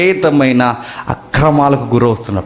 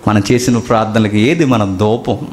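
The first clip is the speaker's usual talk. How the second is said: ordinary delivery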